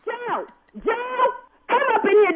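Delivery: high, urgent call to the next room. Real, not acted. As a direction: yell for someone to come. Mother calls husband mid-call.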